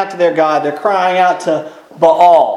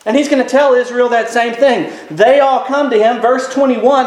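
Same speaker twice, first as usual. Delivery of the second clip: ordinary delivery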